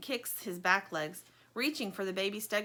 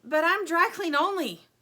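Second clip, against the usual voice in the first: higher, louder anxious protest; scared protest; 9 semitones higher, 8 dB louder than usual. Acted. Stingray afraid of the snow, anxious protest. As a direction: plead your way out of it. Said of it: "Fear crests over Stingray".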